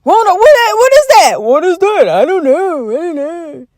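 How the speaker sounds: lightly voiced baffled officials, rising then fading; hammed-up helpless confusion; mock clueless government. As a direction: play dumb like a shrugging official. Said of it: "They say".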